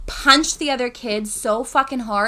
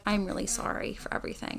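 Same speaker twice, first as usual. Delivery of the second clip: whispered apology; 2 semitones lower, 14 dB quieter, faster than usual. Retold or imitated. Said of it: "texted me and was like".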